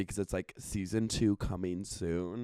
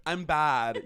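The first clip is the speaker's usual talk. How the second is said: normal talking voice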